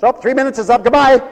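high, loud hollered sign-off; mimicked abrupt phone goodbye. Preacher mimics John ending a long distance call, high holler. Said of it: "He'd call for three minutes".

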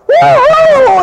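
very high, loud whoop; joyful yell. Acted. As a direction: whoop like kids let loose. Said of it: "you could see 'em yelling".

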